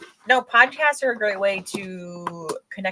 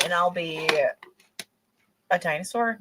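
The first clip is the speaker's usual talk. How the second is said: ordinary talk, nothing marked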